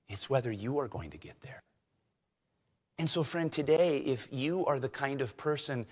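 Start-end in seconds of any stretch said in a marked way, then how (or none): 0.1-1.6 urgent whisper. Acted. Preacher drops to whisper.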